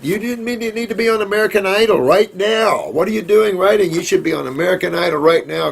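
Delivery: high, excited mock disbelief at a listener; playful teasing. Real, not acted. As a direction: tease her with delight like a fan. Teasing a listener about singing.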